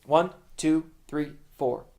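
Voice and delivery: brisk, evenly spaced count; fast tempo count-in. Shown as a demonstration. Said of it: "If the tempo's fast".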